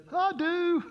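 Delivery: nervous groom forcing out his vows, strained; strained harsh voice. Acted. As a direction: choke the words out high and tight. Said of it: "in a very strained and harsh voice".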